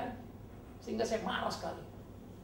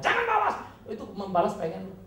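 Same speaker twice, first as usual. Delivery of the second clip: ordinary delivery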